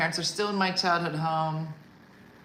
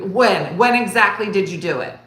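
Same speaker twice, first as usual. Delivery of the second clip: louder, pressing mock mother; aggressive interrogation; 3 semitones higher, 10 dB louder than usual. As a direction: grill him like a detective mom. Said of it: "kinda aggressive".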